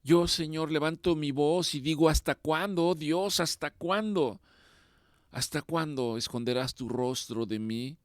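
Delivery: impassioned lament prayer. Acted. Preacher voicing the psalmist, crying out how long.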